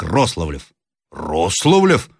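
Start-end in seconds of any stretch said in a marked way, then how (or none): 1.1-2.1 sudden eager question on the name; lively surprised echo. A narrator's reading. Sergeant repeating the officer's surname, with lively surprise. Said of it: "повторил с необычайной живостью сержант".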